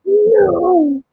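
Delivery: high, drawn-out "no"; long high cry of protest; dismayed wail. Real, not acted. Dismayed that viewers missed everything.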